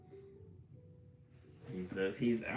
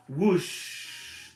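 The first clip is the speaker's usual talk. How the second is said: higher whooshing sound effect; whooshing wind; 9 semitones higher, 8 dB louder than usual. Acted. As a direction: whoosh like big wings.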